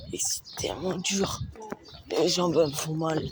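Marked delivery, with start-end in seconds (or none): 0.1-1.4 breathless whisper. Real, not acted. Exhausted runner gasping mid-marathon.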